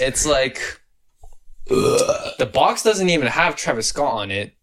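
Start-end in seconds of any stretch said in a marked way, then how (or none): 1.7-2.3 unvoiced groan of disgust; disgusted ugh. Real, not acted. Reacting to a letdown burger meal, disgusted groan.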